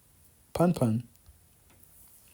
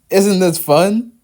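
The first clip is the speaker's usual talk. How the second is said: high, loud laughing delight; giggling, tickled by the teasing; 7 semitones higher, 9 dB louder, slower than usual. Acted. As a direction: laugh through the question. Laughing at the banter.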